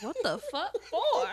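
high, loud disbelief; incredulous. Retold or imitated. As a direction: ask it in shocked disbelief. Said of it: "And I'm like".

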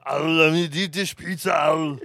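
high mock Austrian accent. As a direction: put on a thick Austrian accent.

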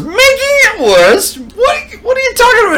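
very high, loud mock disbelief; incredulous retort. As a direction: squawk it back as an outraged question. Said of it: "And he goes".

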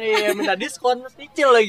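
mock the stingy buyer. Mocking stingy auntie.

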